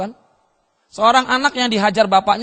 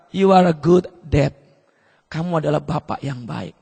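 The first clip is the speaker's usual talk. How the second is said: tender; 8 semitones lower than usual. Retold or imitated. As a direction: say it gently, like a long-awaited gift. Son's moving words retold. (lembut dan pelan penuh haru)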